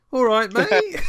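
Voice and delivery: a hammed-up Bristol greeting; full Bristol. Batman greeting in a Bristol accent, hammed up. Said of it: "when you go full Bristol".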